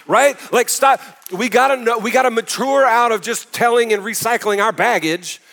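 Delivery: high, loud, driving sermon delivery; preaching at full force. Real, not acted.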